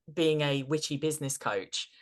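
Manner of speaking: ordinary talk, nothing marked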